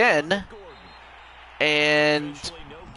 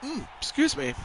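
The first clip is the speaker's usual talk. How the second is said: hiccups; 8 semitones higher, 8 dB quieter than usual. Real, not acted. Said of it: "I have the hiccups".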